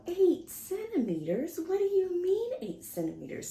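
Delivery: mock stunned disbelief. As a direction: relive the shock of the news. Retells her shock.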